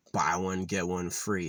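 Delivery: ordinary delivery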